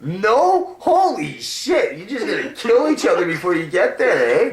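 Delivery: high, loud amazed fan; excited yell. Retold or imitated. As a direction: marvel at the brawl out loud, pitched high. Hyped fan yelling at players.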